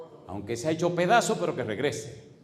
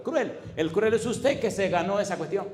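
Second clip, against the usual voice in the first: high, louder throw-back of cruel; indignant retort; 6 semitones higher, 3 dB louder than usual. Retold or imitated. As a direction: scoff at the word cruel.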